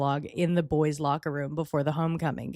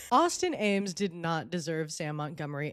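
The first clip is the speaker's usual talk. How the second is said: usual speaking voice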